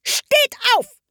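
very high, penetrating shriek; piercing royal command; piercing yell. A narrator's reading. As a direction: shriek the order like a tyrant queen. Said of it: "schrie die Königin mit durchdringender Stimme".

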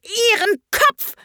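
high-pitched enraged queen's roar; furious royal command, shrieked; bellowing. A narrator's reading. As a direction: bellow it high, stretching the words. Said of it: "fing sie an zu brüllen".

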